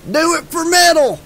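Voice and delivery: high-pitched rowdy yell; whooping, high cheer. A narrator's reading. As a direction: yell it like a chant to egg a friend on. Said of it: "yelled Duke".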